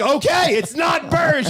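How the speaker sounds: high, loud retold outburst; exasperated shout. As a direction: yell it, out of all patience. Exasperated retort to panicking woman.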